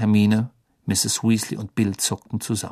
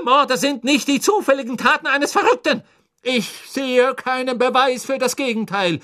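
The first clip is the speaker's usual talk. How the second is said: higher, angry minister; angry cry; 12 semitones higher than usual. Acted. Minister angrily shouts back at the professor, higher voice. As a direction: fling it back furiously. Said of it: "rief Fudge nicht weniger zornig".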